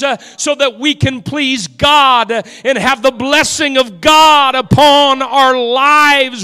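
rising pulpit cry, each vow hammered harder; preaching at full force. Acted. Sermon climax, congregation vows.

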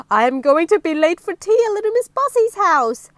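loud, high-pitched fluster; alarmed cry. Acted. Mister Brave late for tea at Miss Bossy's, alarmed cry. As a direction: exclaim it in a mock panic. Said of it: "he cried".

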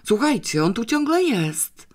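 high, eager announcement; can't believe the dog's still here; boy calls family, excited. A narrator's reading.

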